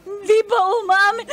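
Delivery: put-on drunk crying. Drunk friend wailing over breakup.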